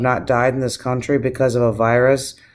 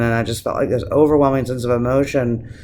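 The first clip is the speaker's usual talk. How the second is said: usual speaking voice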